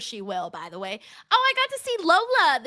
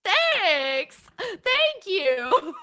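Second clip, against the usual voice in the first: higher, giggly flustered thank-you; bashful thanks; 6 semitones higher, much slower than usual. Retold or imitated. Retelling her reply to Lola, mock bashful and giggling. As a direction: blush your way through it. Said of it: "And I'm like".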